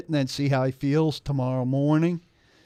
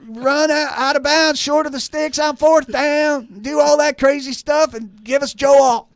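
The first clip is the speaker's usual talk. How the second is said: higher, louder, slow fan yell; loud fan rant; 13 semitones higher, 9 dB louder than usual. Pretend. Impersonating fans' demands.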